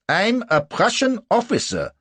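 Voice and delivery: loud, high-pitched protest; indignant Prussian officer. A narrator's reading. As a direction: assert your rank indignantly. Indignant Prussian asserts his rank.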